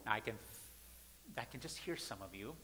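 ordinary talk

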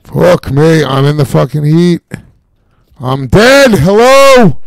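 enraged gamer yell; real screaming. Real, not acted. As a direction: scream in real frustration at dying. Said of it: "I'm just screaming".

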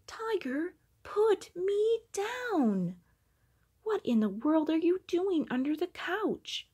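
put-on high, loud alarmed child. A narrator's reading. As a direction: cry out from up in the air. Child lifted into air.

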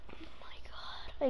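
slow, barely-there whisper; stunned whisper. Real, not acted. Gamer stunned by the win, whispering. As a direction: whisper it in disbelief.

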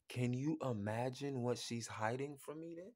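ordinary talk, nothing marked

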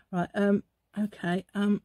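ordinary talk

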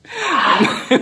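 sudden high laugh bursts out; loud burst of laughter. Real, not acted. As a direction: crack up loudly. Friends laughing at a sour face.

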